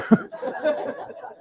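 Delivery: high breathy burst of laughter; laughing. Real, not acted. Storyteller laughing after the general's reaction, high laugh. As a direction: let a high laugh escape.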